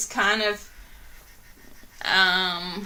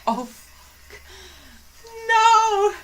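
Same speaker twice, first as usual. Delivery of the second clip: panicked realization; 13 semitones higher, much slower than usual. Retold or imitated. Realizing her girlfriend is upset, panicked whisper then yelp.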